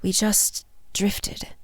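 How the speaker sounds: slow, hushed, nearly breath only; softening voice. A narrator's reading. Fina recalls her broken engagement, softening voice. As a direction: let it soften into a wistful, halting murmur. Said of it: "her voice softening".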